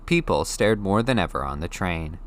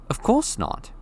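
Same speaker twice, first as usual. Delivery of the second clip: put-on eager boy voice; slower than usual. Harry replies eagerly.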